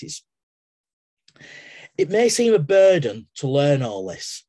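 ordinary talk, nothing marked

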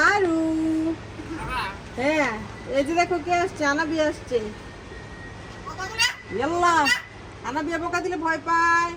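high, sing-song voice for the little ones. Real, not acted. Talking to baby and child.

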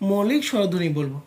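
normal talking voice

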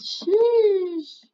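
high, mock-impressed sheesh; a squeaky sheesh at the loot. Real, not acted. Friend finds collector tubbies, squeaky impressed sheesh.

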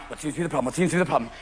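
low, soft repeated practice; rehearsed line. Acted. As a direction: run the line under your breath before facing the cop. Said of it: "you've rehearsed your line".

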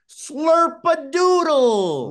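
high, slow, sing-song Slurp-a-doodle; playful call. Shown as a demonstration. Host leads co-hosts in a fun chant, playful sing-song. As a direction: call it out for the group to echo. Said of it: "repeat after me".

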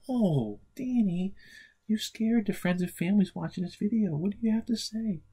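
high, playful mock scold. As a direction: tease the puppet for scaring everyone. Host scolds puppet in puppet voice.

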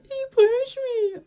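very high, loud, slow whiny tattle; mock crying girl. Girl wailing to the teacher, mock crying high and loud. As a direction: imitate a wailing little girl telling on him. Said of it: "she over here talking".

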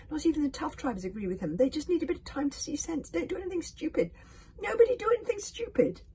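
high, loud plea building in pitch; rising alarm, climbing to a shout; urgent cry. A narrator's reading. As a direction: call out frantically to hold them back. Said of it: "cried Hiccup to the dragons".

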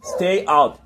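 loud firm command to the dogs; commanding shout. Real, not acted. Dog owner ordering his dogs to stay, loud command.

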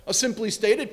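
ordinary delivery

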